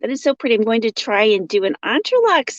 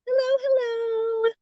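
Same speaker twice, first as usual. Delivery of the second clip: sing-song hello; 13 semitones higher, 6 dB quieter than usual. Real, not acted. Greeting a viewer in a sing-song voice.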